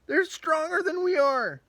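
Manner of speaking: very high, loud, fast panicky refusal; panicked crowd. Retold or imitated. The crowd refuses Caleb, in a shrieking panic. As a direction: squeal in alarm. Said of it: "and then everyone says".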